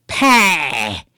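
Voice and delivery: Pie drawn out as a broad Southern "Pay."; mock Southern accent. Host mocking the film's Southern accent, exaggerated "Pay.". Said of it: "It sounds like Eric Cartman".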